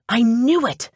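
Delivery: high-pitched gleeful triumph; triumphant. A narrator's reading. Assistant learns her suspect fled, triumphant. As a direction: exclaim it like a fist pump.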